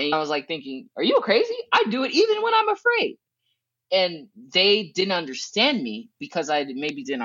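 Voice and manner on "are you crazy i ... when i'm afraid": very high-pitched mock disbelief; incredulous retort. Speaker answering friend who calls her fearless, incredulous retort. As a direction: protest in exaggerated high-voiced disbelief.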